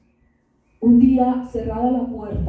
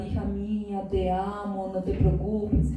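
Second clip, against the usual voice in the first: tender comforting voice; 3 semitones lower, 7 dB quieter than usual. Retold or imitated. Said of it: "A consentir y apacentar".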